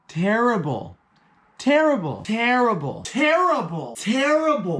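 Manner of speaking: TERRIBLE repeated high, each one falling sharply in pitch; comic emphatic repetition. Real, not acted. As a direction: hammer every "terrible" with a high, drawn-out swoop down.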